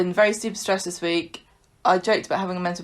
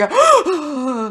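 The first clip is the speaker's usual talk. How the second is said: imitated gasp from cold water; big, high acted gasp; shocked gasp; 7 semitones higher, 4 dB louder than usual. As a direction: gasp as if sprayed in the face. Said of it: "like this".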